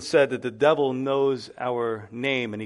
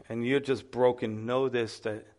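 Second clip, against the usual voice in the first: ordinary talk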